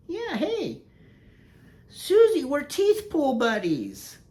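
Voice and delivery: squeaky high dog voice, loud on the name; high, slow pet voice; dog's voice. Pretend. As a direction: speak for the little dog in a high cartoon voice.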